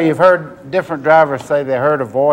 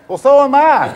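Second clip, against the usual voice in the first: higher, mock deadpan reply; wry retort; 8 semitones higher than usual. Driver answering the strange radio voice, wry and higher. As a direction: answer the radio voice with a dry joke. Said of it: "And I went".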